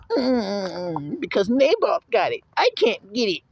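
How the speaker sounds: lightly high, whimpering brat; mock crybaby whine; whiny king. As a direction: pout it like a spoiled child. Mocking Ahab's whining again.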